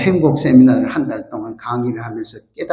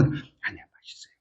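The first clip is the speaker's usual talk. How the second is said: whispered, swallowing the complaint; hushed correction; 18 dB quieter than usual. Retold or imitated. Pastor biting back a complaint at the restaurant, whispered. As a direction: catch yourself and whisper the praise. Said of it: "확 집어넣고".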